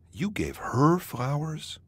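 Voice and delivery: slow question with "her" punched high; stress on HER. Shown as a demonstration. Narrator showing how stress changes meaning, stress on "her". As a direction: hit "her" hard, questioning who got the flowers. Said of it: "By changing the stress, you change".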